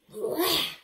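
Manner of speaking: high, grossed-out exclamations; disgusted. Acted. Bruce cleaning up after goslings, grossed out. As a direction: recoil at something gross. Hard work raising goslings.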